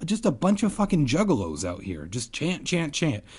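high-pitched whiny friend. A narrator's reading. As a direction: squeak it out in a high voice. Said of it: "Ben whispered in my ear".

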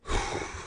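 soft breathy sigh; tired sigh. Real, not acted. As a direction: let out a long sigh. After reading a chapter.

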